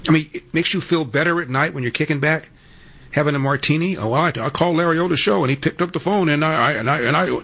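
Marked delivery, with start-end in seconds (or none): 4.0-7.4 mocking the caller. Host mocks caller's bragging.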